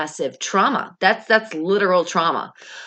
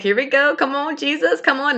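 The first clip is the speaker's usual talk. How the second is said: much higher, excited cheering; hyped up; 10 semitones higher, 3 dB louder than usual. Retold or imitated. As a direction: pump yourself up like a fan. Hyping herself up at work.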